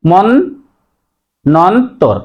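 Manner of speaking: মন্বন্তর very slowly as mon, non, tor; slow split mon-non-tor for মন্বন্তর; correct pronunciation. Shown as a demonstration. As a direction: sound out মন্বন্তর syllable by syllable with double n. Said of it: "সঠিক উচ্চারণ".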